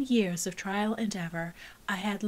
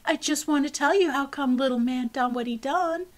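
urgent explaining; 5 semitones higher, 6 dB louder than usual. Acted. Child pleading with teacher.

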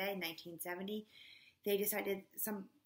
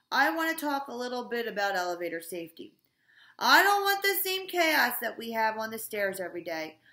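booming, much louder announcement voice; principal on the loudspeaker; 4 semitones higher, 10 dB louder than usual. A narrator's reading. Mister Kitzwatter greets the school over the loudspeaker, booming loud. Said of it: "said Mister Kitzwater".

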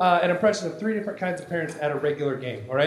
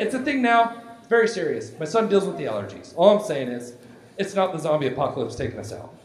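usual speaking voice

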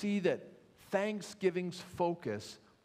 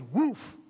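dog bark; 3 semitones higher, 5 dB louder than usual. Acted. German Shepherd barking at the counter, mock bark. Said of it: "puts the purse down and goes".

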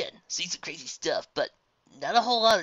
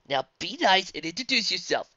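ordinary delivery

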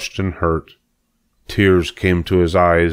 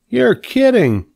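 put-on high, astonished young pig; 3 dB louder, slower than usual. Wilbur the pig, bolt upright at Charlotte's leg facts, astonished.

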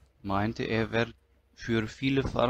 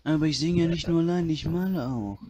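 [name] impression; 4 semitones higher, faster than usual. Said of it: "er macht auch einen schönen [name]".

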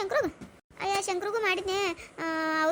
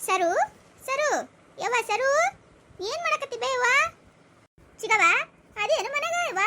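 high-pitched hail from outside the house; calling out; 6 semitones higher, 5 dB louder than usual. Acted. Visitor calling for the family at the door, calling out. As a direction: call names loudly to find who's home.

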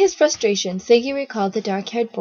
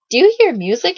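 high, louder surprised question; puzzled wonder; 7 semitones higher, 8 dB louder than usual. A narrator's reading. Guide hearing radio at first sounds, surprised question. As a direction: ask it high in sudden curiosity. Boy puzzled by sudden music.

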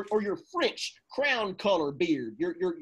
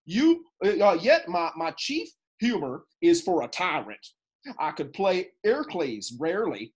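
ordinary talk

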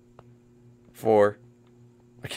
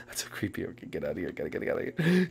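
whispering; 13 semitones lower, 15 dB quieter, much faster than usual. Real, not acted. Hiding near seeker.

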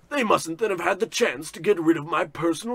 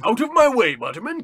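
snapped, louder and higher; angry; 7 semitones higher, 7 dB louder than usual. Acted. Ruined boss shoving his servant aside, angry. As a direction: bark it at the servant.

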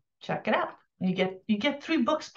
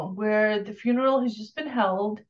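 normal talking voice

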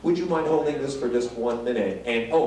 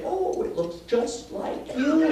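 friends gushing; 11 semitones higher, slower than usual. Retold or imitated. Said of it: "all my friends say".

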